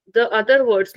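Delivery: normal talking voice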